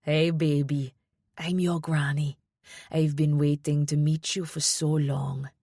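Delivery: put-on strong Jamaican accent, gentle greeting. A narrator's reading. Grandmother meeting her granddaughter, strong Jamaican accent. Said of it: "spoke in a strong Jamaican accent".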